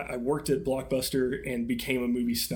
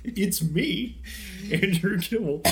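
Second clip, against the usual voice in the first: imitating an over-eager introduction, pitched up; mock excited; 5 semitones higher, slower than usual. Host teasing cohost about his name, mock excited. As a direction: parrot the excited name announcement in a higher voice. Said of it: "sounded so excited".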